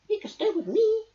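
high, sing-song offer; eager bird voice; trilling Bird. A narrator's reading. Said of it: "trilled Bird".

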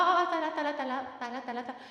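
mildly panicked, repetitive blurting; flustered. Real, not acted. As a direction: fret aloud over a little mishap. Flustered by slide clicker mishap.

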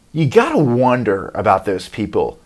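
ordinary talk, nothing marked

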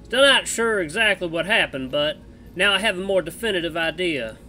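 put-on loud, drawling cowboy accent; Misha cowboy accent. Streamer voicing Misha the bat to Jenna, cowboy accent. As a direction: play the bat as a rough cowboy, American West accent. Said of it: "So I'm gonna try and do a cowboy accent for Misha".

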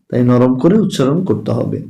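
ordinary talk, nothing marked

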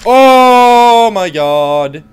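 slow, loud drawn-out gasp of shock; stretched-out awed shock. Real, not acted.

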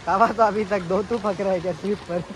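ordinary talk, nothing marked

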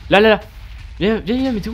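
excited calling. Real, not acted. Urgent calls during fight.

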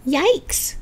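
high startled yelp, lightly acted; a small frightened cry; mock scream. A narrator's reading. Eddie startled into the library, yelping. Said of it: "Eddie screamed".

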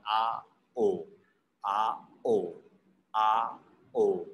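"Ah, oh" as open ah, then rounded oh; wide-open "Ah" ending on a rounded "oh"; two-part O glide. Shown as a demonstration. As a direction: model "Ah, oh" slowly in two parts, low. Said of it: "your mouth will be rounded".

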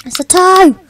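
loud, thrilled guess; excited shout. Real, not acted. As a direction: blurt it out with excitement. Excited guess in drawing game.

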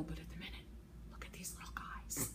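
hushed, delighted whisper. Real, not acted. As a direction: whisper as if sharing a secret. Showing off the pom-poms.

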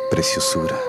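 flirty. Acted. Flirting goodbye, calling her beautiful.

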